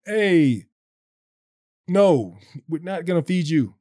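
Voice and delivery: lightly voiced apostles. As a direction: play the apostles brushing the crowd off, lightly. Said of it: "that's my impression of them".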